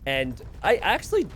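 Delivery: ordinary talk, nothing marked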